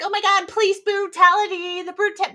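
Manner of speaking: high, mocking outcry; mocking outrage. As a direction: parody the crowd's shocked outrage. Mocking police brutality outcry.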